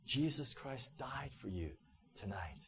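very soft, slow and solemn; low-key, reverent murmur of remembered words; hushed inner echo. Retold or imitated. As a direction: let the words reverberate quietly, falling away at the end. Words reverberating in his heart.

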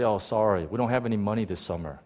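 low, soft letdown; subdued retold apology; apologetic parent. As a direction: let them down quietly, as a parent. Parents letting the children down.

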